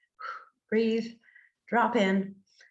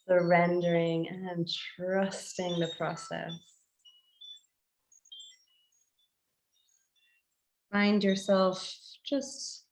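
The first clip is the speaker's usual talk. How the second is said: guided meditation; 4 dB quieter than usual. Acted. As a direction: guide listeners gently inward, phrase by unhurried phrase.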